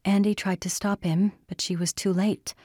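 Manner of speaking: ordinary delivery, nothing marked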